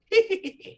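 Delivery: shrill mad giggle; high jester cackle. Acted. Harlequin mocks the party with a high cackle.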